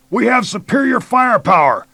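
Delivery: high, loud command; shouting. A narrator's reading. Major challenging hidden attackers, loud shout. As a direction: shout the demand into the trees.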